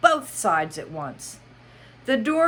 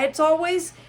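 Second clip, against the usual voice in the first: Mad Hatter voice; 9 semitones higher, 5 dB louder than usual. Acted. Hatter speaking.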